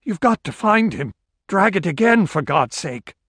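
high-pitched, urgent pleading, falling at the end; desperate plea. A narrator's reading. Desperate man pleads with searcher.

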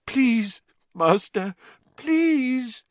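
high, pitiful begging; whimpering plea. A narrator's reading. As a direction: plead pitifully. Wormtail begs.

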